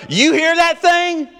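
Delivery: high, loud, drawn-out nagging question; shrill-pitched mock wife voice; exasperated wife. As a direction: play the fed-up wife calling over the crying. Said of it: "your wife goes".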